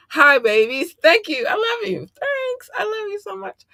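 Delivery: high-pitched squeal of affection. Real, not acted. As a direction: gush lovingly in a tiny high voice. Gushing thanks to listeners abroad.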